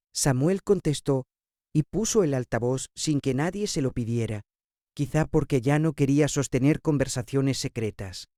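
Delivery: plain reading voice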